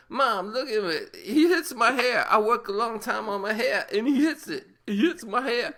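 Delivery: high, whining complaint to mom; whiny Tony. Retold or imitated. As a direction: whine like a spoiled young man.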